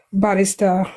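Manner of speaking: ordinary delivery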